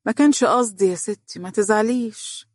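crafty, coaxing man's excuse; sly. A narrator's reading. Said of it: "باسم بخبث".

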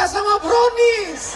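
hammy comic reveal; drawn-out laughing shout. Acted. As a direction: stretch it slowly, loud and high, laughing. Comic punchline. (teriakan panjang sambil tertawa)

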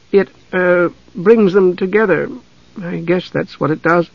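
put-on high, halting girl's explanation; embarrassed. A narrator's reading. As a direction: falter shyly between phrases. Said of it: "her cheeks flushing crimson".

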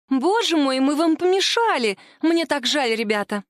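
cartoon princess. Angela fakes innocent apology.

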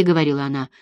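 ordinary delivery, nothing marked

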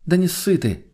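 high, playful dare; amused goading. A narrator's reading. Girl urging the wary friend on, amused and high. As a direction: tease the coward along lightly. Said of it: "засмеялась девушка".

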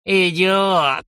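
giggling old geezer, mildly voiced; cackling old man. A narrator's reading. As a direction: snicker it like a nasty old man. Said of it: "невидимый старик захихикал".

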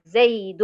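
loud "زيد" as zay-dun; tanween as n. Shown as a demonstration. Teacher before asking about stopping, "زيد" loudly as zaydun. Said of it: "بقف عليها بإيه".

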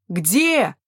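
one drawn-up, questioning cry; searching call. A narrator's reading. Young man searching nearby, calling out. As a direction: call out, voice arching up and back down. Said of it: "прозвучал крик Нюбао совсем рядом".

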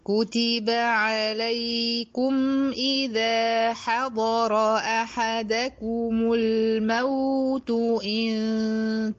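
slow chanted tajweed recitation; Quran recitation. Shown as a demonstration. Teacher recites the verse. (تلاوة مجودة بطيئة)

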